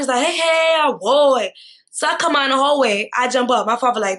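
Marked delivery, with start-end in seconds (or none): 0.3-1.5 retold mocking taunt; mocking laugh-call. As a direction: jeer through a laugh. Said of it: "she's laughing".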